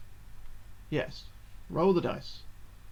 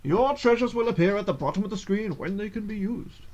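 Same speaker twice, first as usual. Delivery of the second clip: ordinary talk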